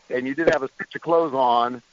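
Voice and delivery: ordinary delivery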